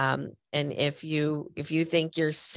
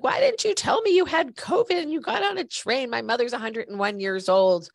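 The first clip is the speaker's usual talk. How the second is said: imitating a frantic stranger, pitched way up high; freaking out; 8 semitones higher, 4 dB louder than usual. Stranger on the train scolding her about covid, frantic high mock outburst. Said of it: "This woman starts freaking out at me".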